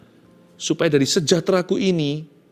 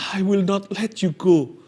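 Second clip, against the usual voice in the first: weinend; 4 semitones higher than usual. Acted. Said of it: "dia menangis".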